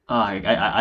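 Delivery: normal talking voice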